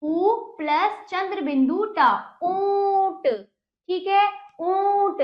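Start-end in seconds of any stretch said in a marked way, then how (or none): 2.4-3.3 ऊंट with a long, held nasal ऊं, higher pitch; teacher sustaining the nasal vowel in ऊंट; nasalised vowel demo. Shown as a demonstration. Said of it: "और नाक से भी". 4.6-5.2 ऊंट with a long, held nasal ऊं, higher pitch; teacher sustaining the nasal vowel in ऊंट; nasalised vowel demo. Shown as a demonstration. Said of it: "और नाक से भी".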